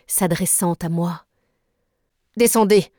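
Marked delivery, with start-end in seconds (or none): 2.4-2.9 short, loud command; curt order. Acted. Nemo ordering the narrator below, curt and loud. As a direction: bark it once. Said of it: "me dit-il d'un ton bref".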